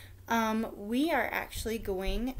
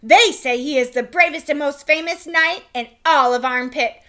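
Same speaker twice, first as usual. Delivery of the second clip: much higher, louder proclamation; herald announcing; 4 semitones higher, 11 dB louder than usual. A narrator's reading. Royal crier proclaiming a knight's arrival, loud and high. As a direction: announce it to the whole court.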